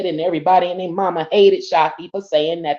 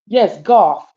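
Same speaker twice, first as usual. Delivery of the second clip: usual speaking voice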